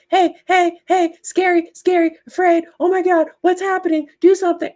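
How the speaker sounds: high, loud frantic alarm voice; the brain shrieking in a mock panic; panicky brain. Vlogger voicing her panicking brain, high frantic alarm. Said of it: "'Cause it's like".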